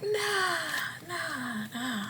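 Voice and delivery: a put-on voice of a kid waving her mom away; dismissive teen nah. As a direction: brush it off like a sulky kid. Astrid brushing off her mom.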